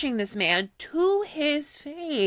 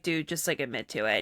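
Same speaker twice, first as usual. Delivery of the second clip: much lower, softer dude voice; mock bro voice; 8 semitones lower, 5 dB quieter than usual. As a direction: drop low like a fed-up bro. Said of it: "everyone was like".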